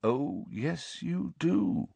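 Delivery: soft and quiet, gently certain; even calmer, unshakably gentle. A narrator's reading. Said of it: "still more calmly".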